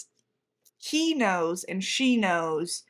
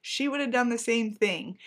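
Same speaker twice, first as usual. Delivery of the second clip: ordinary delivery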